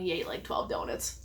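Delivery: whispered aside to the audience; a whispered gossip aside; confiding whisper. Real, not acted. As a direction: let them in on the secret in a whisper. Aside to viewers about husband.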